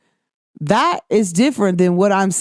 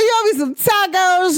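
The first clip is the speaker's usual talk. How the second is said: much higher, teasing demand for tacos; playful demand; 10 semitones higher than usual. Retold or imitated. Teasing her friend about owed tacos, playfully high. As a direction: ham up the claim with a laugh in it. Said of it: "I was like".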